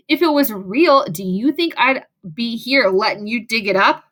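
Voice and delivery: plain reading voice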